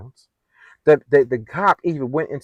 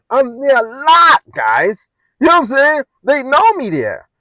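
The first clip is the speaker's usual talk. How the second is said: emphatic; 10 semitones higher, 6 dB louder, slower than usual. Real, not acted. Emphatic insistence to viewers.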